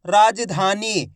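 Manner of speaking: राजधानी slowly, long vowels held; drawn-out pronunciation. Shown as a demonstration. Teacher asking learners to listen well, राजधानी slow and drawn out. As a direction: stretch राजधानी out part by part. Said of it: "रा का उच्चारण खींच को".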